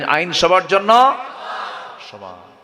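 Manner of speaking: preacher's drawn-out questioning call; rousing prompt. Real, not acted. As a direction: stretch it out and lift জন্য for the crowd to answer. Repeats prompt to rouse crowd.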